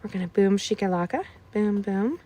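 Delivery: usual speaking voice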